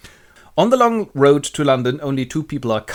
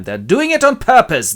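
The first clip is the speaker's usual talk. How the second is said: high, loud grumble; exasperated complaint; 11 semitones higher, 6 dB louder than usual. Acted. Workhouse official interrupted by dying pauper, high exasperation. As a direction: complain as if personally wronged.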